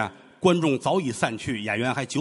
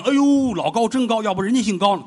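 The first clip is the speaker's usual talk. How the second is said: high, loud mock audience gush; admiring audience; 8 semitones higher, 6 dB louder than usual. Audience hears the high note, mock delighted praise. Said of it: "大伙一听".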